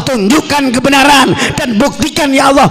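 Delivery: high, impassioned public prayer; fervent preaching. Real, not acted. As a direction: cry the plea out to the whole crowd. Prays fervently at full force.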